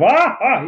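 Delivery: hammed-up take on a colleague's laugh; mock Australian laugh. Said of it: "he laughs in Australian".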